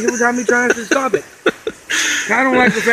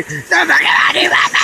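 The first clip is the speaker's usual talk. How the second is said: higher, hammed-up yell of a man in the water; mock drowning yell; 8 semitones higher than usual. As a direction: yell it like you're half under the water. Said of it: "Kyle could be drowning and be".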